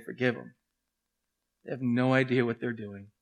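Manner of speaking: hushed forgiving words from the cross; quiet plea. Retold or imitated. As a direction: plead gently for his killers. Jesus dying on cross.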